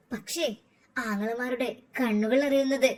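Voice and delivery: usual speaking voice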